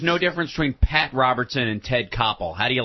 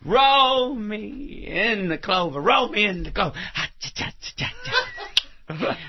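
Ruth Gordon; 4 semitones higher than usual. Acted. Comedian showing off for the host, Ruth Gordon impression. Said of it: "about to do his Ruth Gordon impression".

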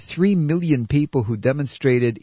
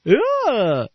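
much higher, lightly voiced sing-song ad voice; mocking an oil ad; 12 semitones higher, slower than usual. Mocking a green commercial in a lightly put-on ad voice. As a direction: parody a cheesy eco commercial. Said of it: "that little green crap".

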